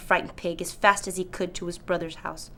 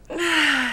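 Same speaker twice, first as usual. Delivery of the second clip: tired sigh; 7 semitones higher, 3 dB louder than usual. Real, not acted. Done reading late.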